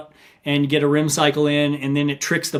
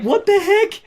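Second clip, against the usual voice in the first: much higher baffled exclamation; startled wife. Retold or imitated. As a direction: react in total disbelief. Wife startled by his accusation.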